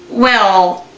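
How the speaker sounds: ordinary talk, nothing marked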